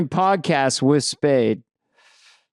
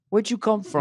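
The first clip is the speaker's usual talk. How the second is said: higher, pushy mock goon; Goomba security guy; 6 semitones higher than usual. Comic retelling the Vegas guard, mock menace. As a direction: lean in like a tough guy. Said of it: "he leans in and goes".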